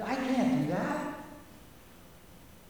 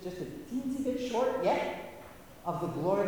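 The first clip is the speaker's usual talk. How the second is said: ordinary delivery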